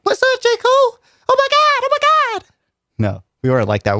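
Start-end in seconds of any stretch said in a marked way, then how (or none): 0.0-2.4 much higher excited fangirl squeal; squealing fan. Retold or imitated. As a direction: shriek it like a starstruck fan meeting an idol. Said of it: "We were like".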